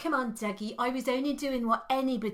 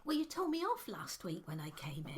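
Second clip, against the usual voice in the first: whispering; 4 semitones lower, 11 dB quieter than usual. Acted. Whispered argument on air.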